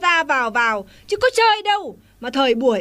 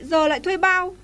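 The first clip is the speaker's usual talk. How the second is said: normal talking voice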